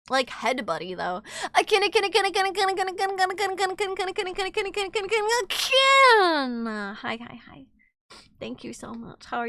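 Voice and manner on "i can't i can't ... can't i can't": high, rattled-off repetition; rapid-fire babble. Pretend. Streamer playfully overwhelmed, high rapid-fire babble. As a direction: chatter it high without a breath, bursting at the end.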